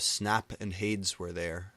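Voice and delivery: usual speaking voice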